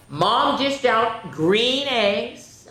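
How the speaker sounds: slow, sing-song read-aloud; story narration. A narrator's reading. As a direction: stretch it out for the children. Reads story, slowed.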